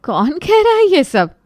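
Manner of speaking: high, loud startled question; who dares say this; alarmed demand. Acted. Mother-in-law hears the rumour, alarmed and loud.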